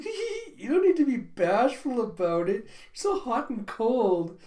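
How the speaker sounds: high giggle into a loud teasing coo; giggly teasing. Acted. Becky teasing Anya about a boy, giggling high. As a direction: tease her with a titter.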